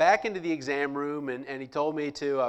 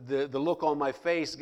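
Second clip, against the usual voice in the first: usual speaking voice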